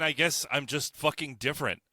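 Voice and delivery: normal talking voice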